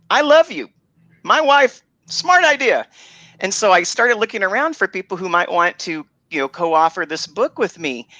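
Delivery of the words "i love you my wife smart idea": high, loud happy burst; husband thrilled by wife's idea, beaming; delighted. Retold or imitated. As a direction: gush with sudden delight. Delighted reply to wife.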